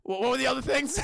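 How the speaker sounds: he forgot the rest mid-speech; mock yell of a flustered boyfriend; blurted shout. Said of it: "he goes".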